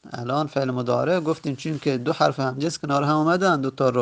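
usual speaking voice

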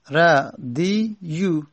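ردیو stretched slowly, syllable by syllable; drawn out slowly. Shown as a demonstration. Teacher slowly spelling out the original form to students. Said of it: "اصلش بوده ردیو".

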